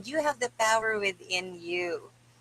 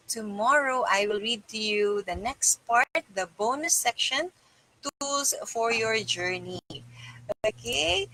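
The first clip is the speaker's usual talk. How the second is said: normal talking voice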